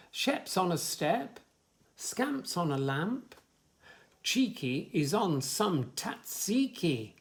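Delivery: sing-song rhyming list; reading to children. Acted. Reader lists rhymes for a child, sing-song. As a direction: bounce through the rhymes for little ones.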